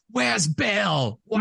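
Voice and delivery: high-pitched mock yell; acted furious demand. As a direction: belt it out like the enraged film heroine. Acts out door-slamming demand.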